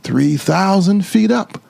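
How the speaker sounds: ordinary delivery, nothing marked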